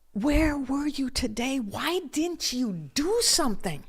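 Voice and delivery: high, slow, drawn-out accusation; stopped yelling but still seething. Retold or imitated. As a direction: demand it slowly with contained anger. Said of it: "seething inside".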